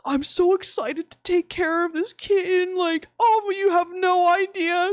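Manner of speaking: high, gushing excitement; mock-adoring high voice; imitated smitten man.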